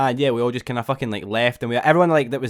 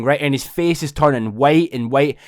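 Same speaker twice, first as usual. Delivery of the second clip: usual speaking voice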